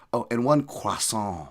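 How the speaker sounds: snooty French "croissant", drawn out with a swallowed R; mock show-off French accent on croissant; affected French accent. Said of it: "say croissant with a French accent".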